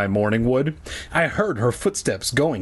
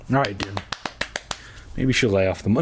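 plain reading voice

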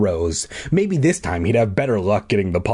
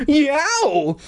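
very high held yelp; surprised shriek. A narrator's reading. Bully startled by a bee on his nose, shriek. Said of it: "he shrieked in surprise".

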